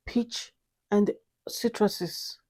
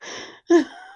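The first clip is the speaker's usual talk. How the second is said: bright high burst of laughter; 10 semitones higher, 6 dB louder than usual. Real, not acted. Laughs after rose voice.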